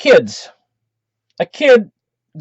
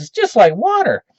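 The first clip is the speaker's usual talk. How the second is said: put-on higher-pitched show-off boy; boastful kid; 8 semitones higher than usual. Jimmy assures the other kids vodka is easy, high boastful kid voice. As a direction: lift the voice into a bragging kid's.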